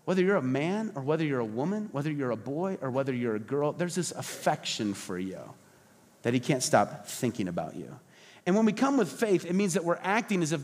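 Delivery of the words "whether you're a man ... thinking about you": much lower and softer, intimate; tender. Real, not acted. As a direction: let the affection settle gently. Tender close on God's affection.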